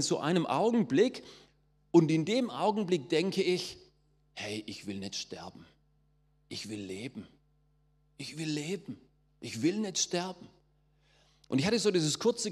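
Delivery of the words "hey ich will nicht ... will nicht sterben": quiet inner voice. Retold or imitated. Inner thought during the moment.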